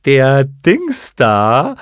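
very slow, loud creature voice; clown moth announcing. A narrator's reading. First moth turns to its companions, announcing loudly. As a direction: stretch each word loudly, like a silly creature. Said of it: "wandte sich die erste Motte".

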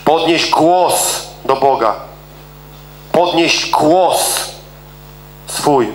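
slow, weighty call to lift the voice; drawn out, each word placed; preacher slowing down to urge; slow emphatic command. Shown as a demonstration. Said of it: "podniesienie głosu".

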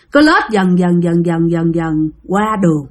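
low, droning repetition; slow creeping. A narrator's reading. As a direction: let it crawl along in a low monotone. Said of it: "lết rất là chậm". (kể trầm, lê thê chậm rãi)

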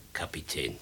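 soft unvoiced whisper. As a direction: breathe the word out with no voice. Servant leaves the captain alone.

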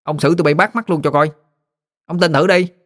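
put-on high-pitched boy protesting; indignant boy. A narrator's reading. Boy defending his grandpa to a bully, high and indignant. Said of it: "Tăng nhảy dựng lên".

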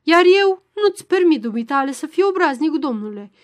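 loud, high-pitched angry outburst; furious. A narrator's reading. Tipsy professor rebukes the magistrate for insolence, furious. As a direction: flare up loudly in indignation. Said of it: "ochi fulgerători de mânie".

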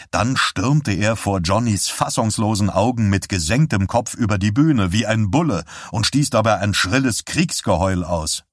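usual speaking voice

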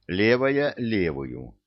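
ordinary delivery, nothing marked

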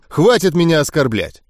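ordinary delivery, nothing marked

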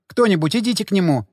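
mild cry for help in a character's voice. A narrator's reading. Jeanelle asks someone to fetch the pastor, mild cry. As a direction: call out, pleading lightly. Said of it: "крикнула Жанель".

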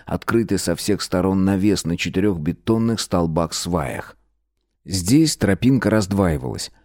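ordinary delivery

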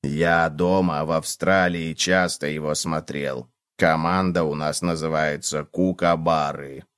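low, slow, soft storyteller bug; quiet, plodding low cockroach voice; earnest cockroach. A narrator's reading. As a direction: reminisce low and unhurried. Cockroach character tells of home.

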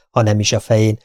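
plain reading voice

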